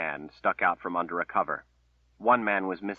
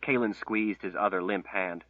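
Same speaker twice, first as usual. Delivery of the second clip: ordinary delivery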